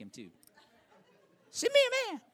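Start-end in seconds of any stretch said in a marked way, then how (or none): 1.5-2.2 high, thin laughing Goliath taunt; squeaky giant's challenge as a joke; Mike Tyson voice. Retold or imitated. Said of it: "like a big fighter like Mike Tyson".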